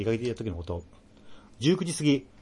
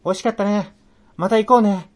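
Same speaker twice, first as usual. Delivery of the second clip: cheerful chirpy; 9 semitones higher, 8 dB louder than usual. Retold or imitated. Cheerful quote voiced.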